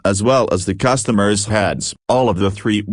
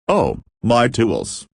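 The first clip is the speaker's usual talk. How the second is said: usual speaking voice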